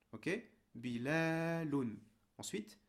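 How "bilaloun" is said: "Bilaloun" very slow, la drawn out; long middle vowel. Shown as a demonstration. Teacher repeating the word for a pupil, long middle vowel. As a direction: stretch the la in "Bilaloun". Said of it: "ça nous donne".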